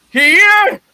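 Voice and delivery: high, loud startled yelp; startled question. Acted. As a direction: jump at the sight of it. Scrooge startled by new place.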